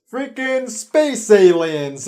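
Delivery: loud, slow, drawn-out outburst; exasperated shout. Real, not acted. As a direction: yell it in disbelief. Outburst at aliens twist.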